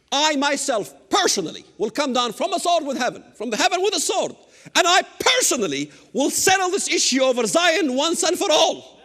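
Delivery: rising to a shout on God's vow; fiery preaching. Acted. Preacher to congregation, builds to a fiery shout.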